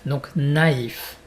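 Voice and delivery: normal talking voice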